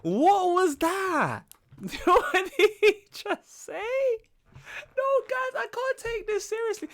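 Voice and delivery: very high, laughing, incredulous cries; baffled disbelief. Real, not acted. Reactor to a bizarre bachelor, high laughing disbelief. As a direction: shriek your confusion, can't believe it.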